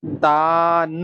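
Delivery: दान with the aa held very long and slow; stretched long aa. Shown as a demonstration. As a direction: pull the aa in दान out slowly. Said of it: "उसे देर तक बोलते हैं". (आ को लंबा खींचकर दान)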